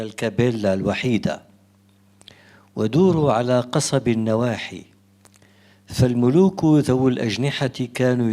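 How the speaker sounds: poetry recitation. Acted. Poet reads from the book to a soirée audience, declaimed verse. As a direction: recite it out line by line, with spaced pauses. Recites own poem aloud.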